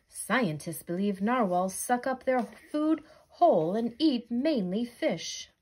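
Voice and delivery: ordinary delivery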